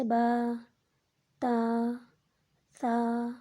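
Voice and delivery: vowel held one count; one alif stretch. Shown as a demonstration. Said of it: "ایک الف کے بقدر کھینچتے ہیں". (ایک الف کے بقدر کھینچ کر)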